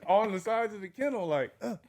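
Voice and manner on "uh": ordinary talk, nothing marked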